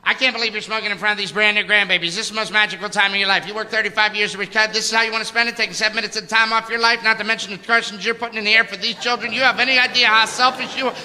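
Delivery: high, fast nonstop scolding; nagging stepmother. Retold or imitated. Dad's wife scolding him for smoking, fast and high. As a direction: rattle off the lecture like a nag. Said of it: "starts to go".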